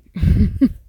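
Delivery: loud high burst of laughter; laughing. Real, not acted. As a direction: laugh loudly at own joke. Laughs at own joke.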